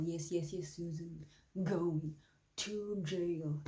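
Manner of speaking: usual speaking voice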